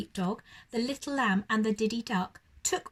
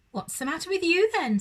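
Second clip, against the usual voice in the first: higher, quick-paced horse voice; a startled horse asking, a bit higher; 8 semitones higher, 4 dB louder, faster than usual. Acted.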